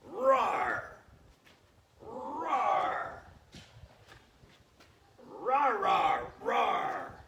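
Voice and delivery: loud, high lion roar. Pretend. Coach acting as favorite animal for the kids, lion roar. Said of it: "Mine is a lion".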